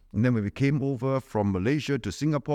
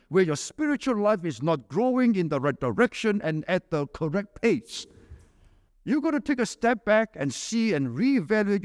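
raised, higher preaching voice; 8 semitones higher than usual. Acted. Preacher urging the congregation to step back, fervent. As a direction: preach it out with conviction.

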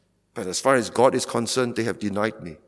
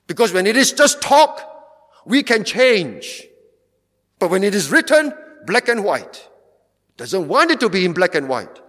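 forceful preaching; 8 semitones higher, 5 dB louder than usual. Acted. Pastor condemning the lecturer to his congregation, preaching hard. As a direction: drive the point home from the pulpit.

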